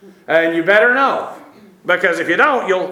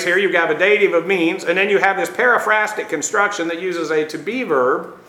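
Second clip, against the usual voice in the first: ordinary delivery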